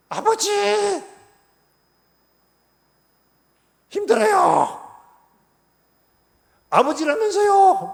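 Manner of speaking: mock desperate outcry, high and loud; crying out in prayer. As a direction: wail to the Father, slow and loud on the last line. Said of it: "소리 지르고 막 난리 치잖아요".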